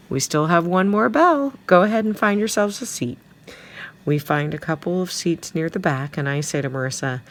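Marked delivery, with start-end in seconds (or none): 0.1-3.2 high, loud, cheerful teacher voice; smiling teacher. A narrator's reading. Homeroom teacher welcoming her new class, smiling and loud. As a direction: project to the class with a smile. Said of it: "then smiles at us and says".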